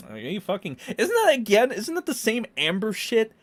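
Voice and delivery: ordinary talk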